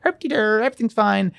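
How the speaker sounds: dopey clueless voice. Retold or imitated. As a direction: play dumb and carefree. Mock clueless self voice.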